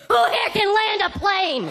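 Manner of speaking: high, slow alarmed call; mock emergency shout. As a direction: call out as if the plane is going down. Mock emergency question in Kinko's.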